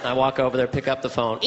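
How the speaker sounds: ordinary talk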